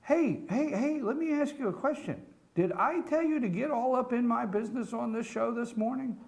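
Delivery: high-pitched, put-upon rebuke; indignant scolding. Real, not acted. Host scolds colleague for butting into show, indignant. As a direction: tell off a meddler with rising indignation.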